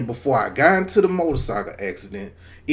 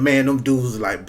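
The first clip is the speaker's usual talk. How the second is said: normal talking voice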